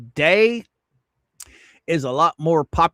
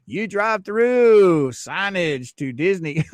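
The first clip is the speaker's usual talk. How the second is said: normal talking voice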